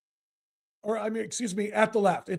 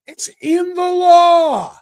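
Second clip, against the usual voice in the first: very slow, loud and higher; emphatic shout; 6 semitones higher, 9 dB louder, much slower than usual. Real, not acted. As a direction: hammer each word home. Angry host berating guest.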